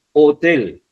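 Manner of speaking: "Hotel" with a loud push on "tel"; teacher's demo of "Hotel", accent on "tel"; stress on last syllable. Shown as a demonstration. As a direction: punch the final syllable of "Hotel". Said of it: "también en la sílaba tel".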